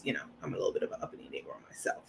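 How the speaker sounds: hushed, fast confiding whisper; whispered aside. Real, not acted. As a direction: drop to a secret whisper for the joke. Joking aside about herself.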